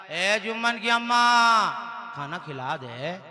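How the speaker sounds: subdued hungry husband; worn-out husband calling. Acted. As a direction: call meekly, sinking very low. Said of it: "फिर आवाज लगाया".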